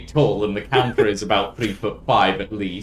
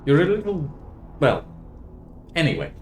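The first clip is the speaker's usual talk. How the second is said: high, amused barmaid voice; 7 semitones higher than usual. Acted. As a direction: wave it off with a high little shrug. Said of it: "nods and goes".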